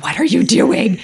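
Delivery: high, loud retold reproach; alarmed challenge. Partner confronting her after she made someone cry, alarmed challenge. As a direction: imitate an appalled partner.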